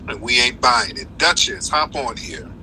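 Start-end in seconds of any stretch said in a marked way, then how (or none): none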